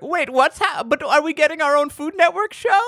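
confused hopeful women. Retold or imitated. Casserole women asking the chef about their show, high and baffled. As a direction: ask it in naive confusion. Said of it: "They're like".

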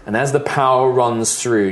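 ordinary talk

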